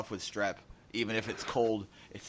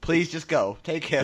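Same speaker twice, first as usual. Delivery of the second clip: mock argument; 7 semitones higher, 6 dB louder than usual. Acted ER argument continues.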